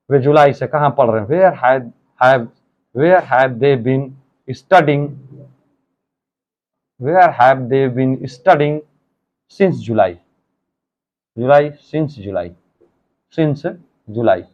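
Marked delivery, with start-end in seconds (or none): 3.0-14.4 very slow, word by word; slow dictation. Shown as a demonstration. Teacher dictates the sentence to students, very slow. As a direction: spell the structure out slowly for the class. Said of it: "इसको देखते रहिएगा structure को".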